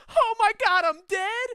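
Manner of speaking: high, very loud mock panic; panicked shout. Dead man discovering he's dead, hammed-up panicked shout. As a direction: yell it out in hammed-up shock.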